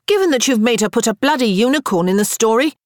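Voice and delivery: high, emphatic sisterly comeback; retort. A narrator's reading. Elizabeth defends herself to her sisters, retorting. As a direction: argue back with force. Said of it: "Elizabeth retorted".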